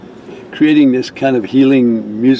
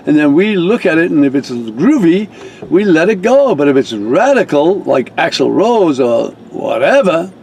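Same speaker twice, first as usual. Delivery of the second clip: much higher, hammed-up prissy official; bureaucratic woman; 5 semitones higher than usual. Staff member explaining the approval process, high mock-prissy. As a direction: lecture him on the committee, mock-prim. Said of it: "I guess that's what they were saying".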